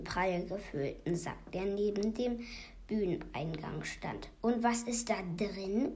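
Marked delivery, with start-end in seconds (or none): none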